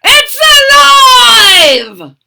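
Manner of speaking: high, loud, slow triumphant shout; booming mad scientist triumph; mad scientist cry. Acted. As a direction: crow it like a mad doctor. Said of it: "Doctor Franken cried".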